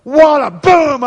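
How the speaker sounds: gleeful hammed-up boom; big excited boom. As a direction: shout the punch like a cartoon hit. Climax of the fight story.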